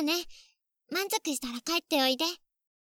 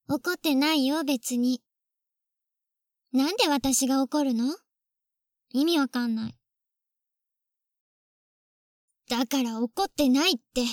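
denying anger; 4 dB louder than usual. Acted. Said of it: "何怒ってるの？".

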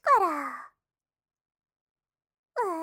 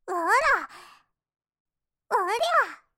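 playful yell; 6 semitones higher, 9 dB louder, much faster than usual. Acted. Mock slap.

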